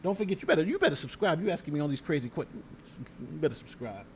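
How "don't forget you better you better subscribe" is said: ordinary delivery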